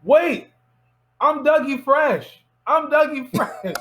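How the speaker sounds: high, pleading shout to be noticed; desperate calling out. Retold or imitated. Retold desperate call to departing star.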